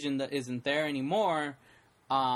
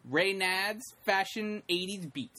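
mock emcee introducing a segment, slowing on the title; show announcer; 5 semitones higher than usual. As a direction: announce the next act to the crowd.

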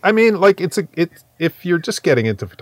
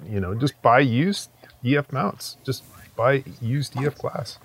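ordinary delivery

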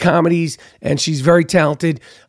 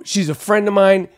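usual speaking voice

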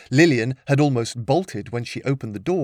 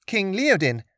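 put-on higher, eager young girl; 8 semitones higher than usual. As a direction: answer like a keen pupil. Lillian answers.

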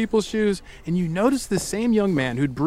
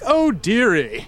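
drawn-out British dismay, higher and slow; mock-shocked British caller; British accent; 5 semitones higher, much slower than usual. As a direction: stretch it out in a hammed-up British accent. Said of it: "but in a British accent".

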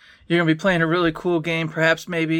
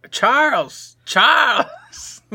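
mock calling out; 7 semitones higher, much slower than usual. Calling after a fading memory.

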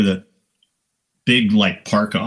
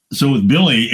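usual speaking voice

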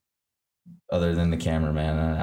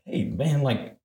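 retold protest; 8 semitones higher, slower than usual.

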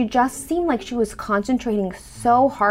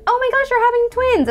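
high, bright-eyed gush of joy; the cheerful way she didn't say it; happy excited; 3 dB louder than usual. Shown as a demonstration. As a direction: squeal it like happy news. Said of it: "not in, like, a happy, positive".

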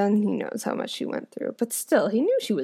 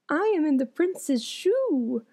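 higher, slow little voice from inside a shoe; little spoon voice; 7 semitones higher, slower than usual. Acted. The hidden spoon owns up to the princess, small and high.

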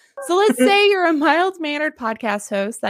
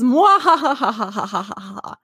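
maniacal laughter. Acted. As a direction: cackle like a mad scientist. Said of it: "maniacal laughter helps a bit".

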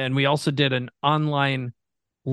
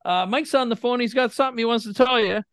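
high, raised imitation of mom calling to dad; mock-yell across the house, pitched up like mom; 9 semitones higher than usual. Mom shouting to dad that their son is on the phone, imitated high call. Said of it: "my mom kinda screams outside".

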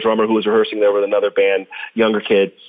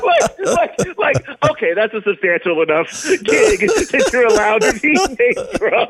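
talking through laughter, pitched up; laughing; 10 semitones higher than usual. Real, not acted. Drummer recounting the kid's verdict while laughing. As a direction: still giggling while explaining the joke.

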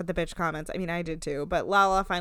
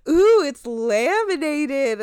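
high, loud, drawn-out fake awe; slow, pitched-up imitation of a sneer; mocking awe; 7 semitones higher, 9 dB louder, much slower than usual. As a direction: taunt with exaggerated wonder. Mimics New York mocking the magazines.

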